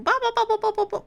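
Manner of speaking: high mock engine revving; engine revs. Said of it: "Switch to second gear".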